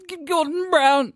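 very high, loud stammered yell; mock outraged shout. Host egged on to rant about politician, mock outraged shout. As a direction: burst out with the name, high and loud. Urged on, yells politician's name.

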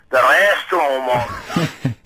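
slow drawn-out mock monster call, laughing; hammed-up monster dad. Middle-class dad chasing his kids round the restaurant, slow hammed-up monster call. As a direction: ham up a silly restaurant monster voice. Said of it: "overdoing it all because he was saying".

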